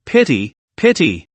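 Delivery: slow, gloating sigh of pity; gloating, slow mock pity. A narrator's reading. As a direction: savour it, pretend to pity him. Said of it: "hard to hide the excitement".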